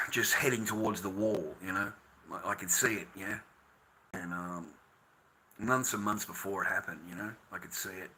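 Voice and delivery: low, hushed admission of helplessness; heartbroken, resigned. Real, not acted. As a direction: let the voice sink, quiet and defeated. Says it broke his heart.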